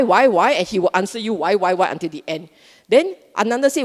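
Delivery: ordinary talk, nothing marked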